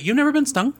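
surprised. Real, not acted. Shocked disbelief at friend.

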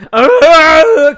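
high loud scream; mock scream. Soldier being punched, mock scream. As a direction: scream as he's hit.

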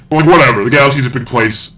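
normal talking voice

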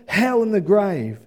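ordinary delivery

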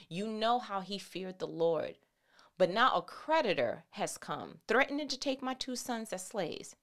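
low, soft Bible reading voice; scripture reading. Acted. Preacher reads Second Kings to the church, low scripture reading. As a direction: read the passage quietly and evenly.

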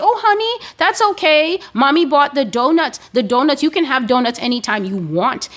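high, cooing baby-talk parent, mocked; mocking doting mommy. Preacher mocks a mother comforting her donut thief in a high coo. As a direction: coo it like an overindulgent mother soothing a crying child. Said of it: "They will kneel down and say".

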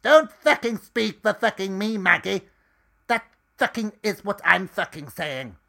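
high, loud shouting at a coworker; furious yell. Acted. Edith yells at Dusty to get off the phone, furious shout. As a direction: bellow him off the phone.

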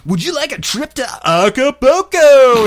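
high, slow put-on salesman voice; prank caller voice. Teen prank caller hamming up a sales pitch. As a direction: lay on a fake telemarketer pitch.

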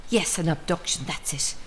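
ordinary delivery

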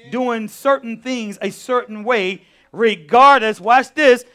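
loud, slow preacher's cry; preaching at full force. Acted. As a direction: drive each word home to the church.

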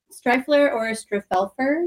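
"Streifler" as STRIFE-ler, then garbled "Striffelfer"; listing mispronunciations. Retold or imitated. Guest reporting how people mangled the surname, "Striffelfer" with extra syllables. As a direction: drag out "Streifler" and stumbling "Striffelfer" slowly. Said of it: "Did they say Streifler?".

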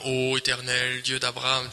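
a hint of churchy solemnity; mock solemn prayer. Shown as a demonstration. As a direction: drone it like a stiff formal prayer. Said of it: "tu vas pas le déclarer comme".

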